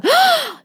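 high, dramatic imitated gasp; shocked gasp. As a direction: gasp at juicy bad news. Retelling mum's shock at news.

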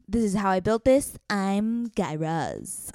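slow, drawn-out podcast host voice; Guy Raz impression. Imitating a podcast host for her cohost, slow and drawn out. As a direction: stretch it out like a radio host. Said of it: "Guy Raz is like".

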